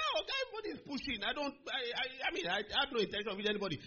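high-pitched, stumbling excuse; flustered protest. Retold or imitated. Young student excusing himself to the bully, high flustered protest.

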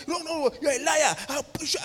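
acted-out high-pitched accusation; mock angry threat. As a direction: imitate an angry man threatening you. Acting out man's threat in quarrel.

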